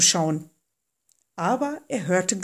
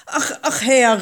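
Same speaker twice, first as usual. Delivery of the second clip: high, pleading exclamation; crying out; 4 semitones higher, 5 dB louder than usual. A narrator's reading. Hesitant Moses appealing to the Lord, crying out. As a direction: exclaim reluctantly, pitched up. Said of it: "rief er".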